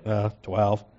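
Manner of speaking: low, hangdog mumble of twelve; sheepish disciples. Acted. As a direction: answer with your head hung low. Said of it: "kind of with their head like".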